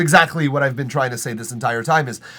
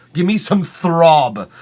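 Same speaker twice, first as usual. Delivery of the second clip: louder and slow, emphatic; 3 semitones higher, 8 dB louder, slower than usual. Real, not acted. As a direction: lean in hard on it. Repeats line louder.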